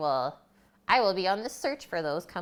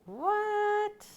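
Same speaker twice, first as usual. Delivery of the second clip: surprised what. Real, not acted. Amazed at the turkey tail find.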